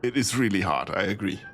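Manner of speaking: ordinary delivery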